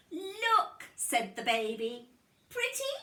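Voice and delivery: put-on very high, tiny baby voice; proud little baby showing off. Baby shows lipstick mess.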